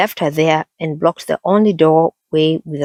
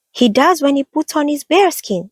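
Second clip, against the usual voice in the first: tiny mouse squeak, much higher; high squeaky voice; 10 semitones higher than usual. A narrator's reading. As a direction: squeak like a little mouse woman. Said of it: "a high, squeaky voice".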